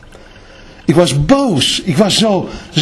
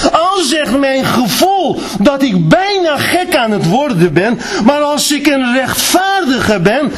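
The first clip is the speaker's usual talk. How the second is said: high, raised cry over his own feelings; preaching at full force; 8 semitones higher than usual. Acted. Preacher shouting that God is faithful despite his feelings. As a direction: cry it out to the church.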